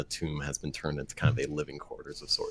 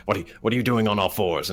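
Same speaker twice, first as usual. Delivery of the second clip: startled hermit; 4 semitones higher, 5 dB louder than usual. Acted. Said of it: "she'll be like".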